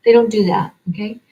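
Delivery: usual speaking voice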